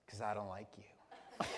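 a low hushed sneer; low mock bully. Bully telling Brian why, in a low sneer. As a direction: mutter it like a kid bully. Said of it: "he goes".

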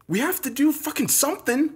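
high-pitched, urgent plea; desperate urging. A narrator's reading. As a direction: urge them desperately to act. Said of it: "I urged them".

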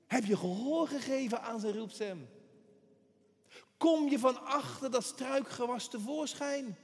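usual speaking voice

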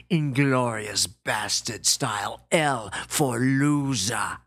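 brutish bully spelling out doom; menacing gorilla. Retold or imitated. Gorilla's threats on phone.

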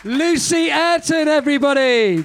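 loud stretched-out announcement; compere announcement. Real, not acted. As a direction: call the poet's name out to the room.

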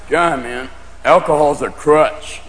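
low, slow stoner voice; stoner friend. Acted. Pot-smoking friend lectures the drinker, low slow stoner. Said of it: "your friends who smoke marijuana going".

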